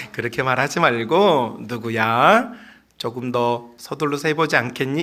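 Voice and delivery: low, soft coaxing; pretty, gentle manner. Retold or imitated. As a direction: gently suggest it, voice dropped low. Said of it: "이렇게 예쁘게".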